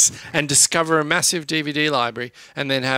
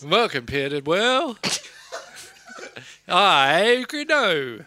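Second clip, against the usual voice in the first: silly voice; 6 semitones higher than usual. Acted. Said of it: "in that voice?".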